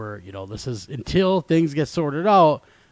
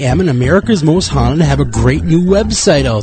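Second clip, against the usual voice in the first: bright, pitched-up promo voice; promo read; 3 semitones higher, 10 dB louder than usual. Acted. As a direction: pitch the website with energy.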